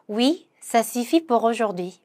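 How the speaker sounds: very slow, careful French; slow pronunciation model; slow demo. Shown as a demonstration. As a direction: lay out each syllable slowly for learners. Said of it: "pronunciation demo".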